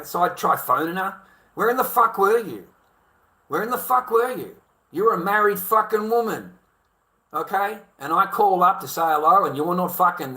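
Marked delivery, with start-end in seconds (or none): 1.5-7.8 louder and higher, hammering the same question; a mild mock yell at her down the phone; retold angry demand. Husband on the phone to his missing wife, angry yell.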